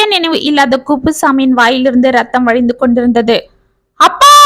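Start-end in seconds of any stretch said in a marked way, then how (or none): 4.0-4.3 a much higher, loud scream. A narrator's reading. Daughter sees blood on father, loud high scream. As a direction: scream it in terror. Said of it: "என்று கண்ணம்மா அலறினாள்".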